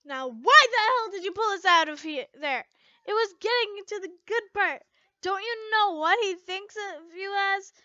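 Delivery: loud, high whiny complaint; annoyed friend protesting; complaining. A narrator's reading. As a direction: gripe at her friend loudly. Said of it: "Levy complained".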